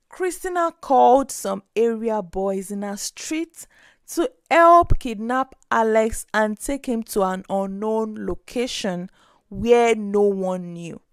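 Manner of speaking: ordinary delivery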